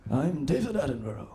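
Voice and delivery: hushed British wildlife presenter; a light Attenborough send-up; David Attenborough impression. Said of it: "Do you know the Planet Earth guy".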